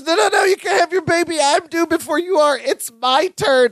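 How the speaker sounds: high-pitched, whiny imitated objection; mock protest. Jennifer to Marianne over who is due first, protesting. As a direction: protest up high, then draw out the end. Pregnant rival protesting in retold scene.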